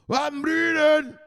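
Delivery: high drawn-out call of a name. Real, not acted. Calling out a friend's name.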